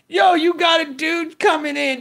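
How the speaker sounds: ordinary talk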